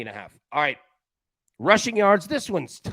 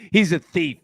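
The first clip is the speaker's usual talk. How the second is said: louder second try at New York; New York accent; 5 dB louder than usual. Retold or imitated. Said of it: "I can't do the New York accent".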